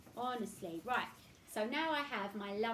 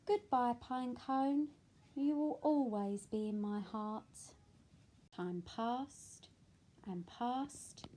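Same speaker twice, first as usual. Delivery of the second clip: put-on reading to children; 4 dB louder, slower than usual. A narrator's reading. Reads picture book aloud.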